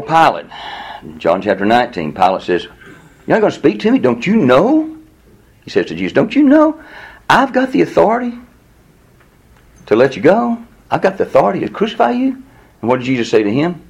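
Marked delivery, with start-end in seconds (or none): 3.3-12.4 high-pitched indignant disbelief; incredulous questioning. Acted. Pilate challenging silent Jesus, high and incredulous. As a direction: press each question upward in disbelief.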